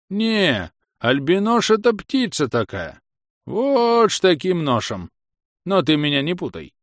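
put-on high, lisping old rustic; stubborn old villager. Old man correcting the inquisitor, high and lisping. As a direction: argue in a high-pitched, rustic old man's voice.

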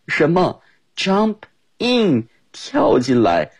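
high, slow mock-shocked question; teacher feigning alarm at the invitation.

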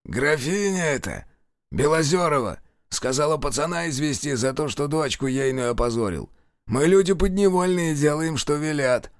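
cornered thug. A narrator's reading. Captured killer confessing.

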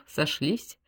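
plain reading voice

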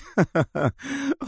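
high, soft mocking laugh. Sonia laughs at Adham's notion, mocking laugh. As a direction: chuckle derisively, high and light. Villainess mocks hero on phone.

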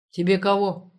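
неприветливо и громко; спросить в лоб, неласково. A narrator's reading. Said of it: "неласково спросил мужик". (неласково, резко)

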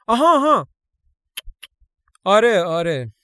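loud, high flustered "aha, aha, yes, yes"; flustered exclaiming. Acted. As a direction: blurt it out, caught off guard and covering. Eileen flustered covering a lie.

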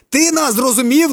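high, loud, slow demand; agitated shout. A narrator's reading. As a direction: press him, still worked up. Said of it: "не можуть заспокоїтись".

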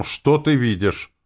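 plain reading voice

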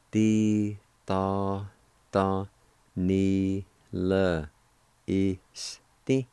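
"Di, tha, tha, ni, le. este." broken into separate syllables with pauses; slow syllable-by-syllable teaching demo. Shown as a demonstration. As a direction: say each syllable on its own, with a pause between. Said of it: "it's pronounced like this".

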